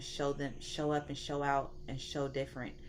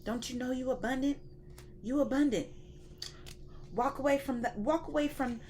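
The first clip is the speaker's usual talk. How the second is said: higher coaxing God talking to his boo; a lightly voiced loving God, pitched up; God voice, sweet and high; 5 semitones higher than usual. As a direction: call her boo boo, lifted and pleading. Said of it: "he was like".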